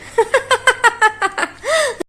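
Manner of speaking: high bursting laugh; mother's laugh. Acted. Mother laughs at her daughter's pleading, a high burst. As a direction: break into a high amused laugh. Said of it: "आप हंस रही हो?".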